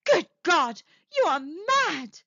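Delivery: loud, high horrified exclamation, dragged out at the end; aghast. A narrator's reading. Husband horrified by his wife's wish, aghast. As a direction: cry out aghast. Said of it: "he cried aghast".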